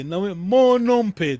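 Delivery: teacher repeats "monompe" slowly so the student hears both accents; slow, loud model of monompe. Shown as a demonstration. "monompe" slowly, both o's accented. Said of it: "Vou repetir".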